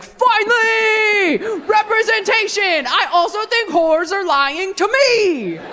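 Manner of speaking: high, slow, shouted hooray; mock triumphant cheer. The church men cheering the Joseph sermon, high mock shout. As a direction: shout it like a long-awaited victory. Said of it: "all the men were like".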